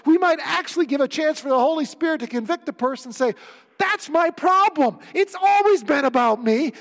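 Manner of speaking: loud, high-pitched sermon at full force; impassioned preaching. Acted. Preacher urging church to tell the whole gospel, impassioned.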